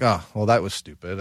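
ordinary delivery

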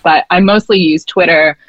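ordinary delivery

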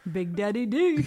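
bro-ish frat guy delivery; lightly voiced frat bro swagger; frat boy voice. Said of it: "we need the frat boy".

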